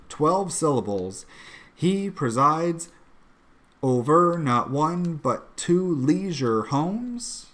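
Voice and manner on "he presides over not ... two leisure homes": slow deliberate read, beats on "over" and "two"; slow scansion reading. Shown as a demonstration. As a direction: read it slowly to expose the odd beats. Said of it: "which just scans strangely".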